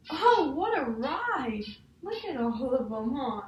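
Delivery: slow, loud, sing-song wonder; delighted exclamation. Acted. Teacher reading to a child, slow and thrilled exclamation. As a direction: savor the ride with big delight. Story exclamation after the ride.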